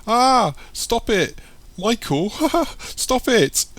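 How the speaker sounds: very high, helpless squeals with laughter; screaming and laughing. A narrator's reading. Sam tickled by Michael, screaming with laughter. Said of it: "she begins to scream and laugh".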